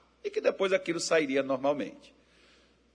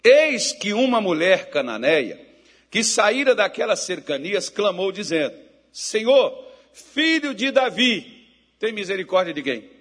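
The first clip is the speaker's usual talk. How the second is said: loud scripture reading; 5 semitones higher, 8 dB louder than usual. Acted. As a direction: proclaim the verse to the church. Reading scripture aloud loudly.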